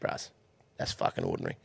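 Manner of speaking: low, soft Kiwi deadpan; old Kiwi hand judging the box, low soft Kiwi deadpan. Retold or imitated. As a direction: drop it flat and low, Kiwi style. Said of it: "He's like".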